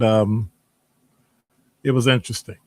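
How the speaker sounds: ordinary talk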